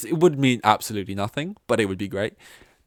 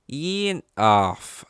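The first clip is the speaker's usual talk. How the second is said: higher, mock Australian accent; botched Australian accent, pitched up; Daniel Ricciardo; 5 semitones higher, 5 dB louder, much slower than usual. Shown as a demonstration. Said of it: "went straight for Daniel Ricciardo there".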